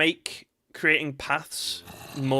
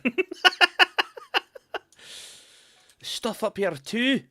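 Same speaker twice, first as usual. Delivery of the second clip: stretched-out, higher Scottish accent; 10 semitones higher, 5 dB louder than usual. Shown as a demonstration. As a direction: repeat it in a high, slow Scottish voice. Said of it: "I don't know why I went Scottish".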